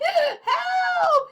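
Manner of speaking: high, loud panicked yell; frantic high shriek. Acted. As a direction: yell for help in terror. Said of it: "he yelled".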